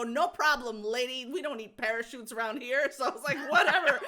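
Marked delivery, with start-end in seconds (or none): 0.0-2.9 louder, sarcastic "No problem, lady"; mock cheerful. Mocking the agent's expectation, loud sarcastic breeziness. 3.5-4.0 loud, high "Whatever."; exasperated shout. Retold or imitated. Fed up with the agent, loud exasperated "Whatever.".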